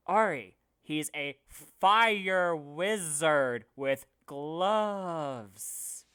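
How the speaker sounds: loud, hugely stretched words; exasperated shout. Retold or imitated. Players hint to Ari at the statue puzzle, exasperated shout. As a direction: spell it out loudly for someone missing the obvious.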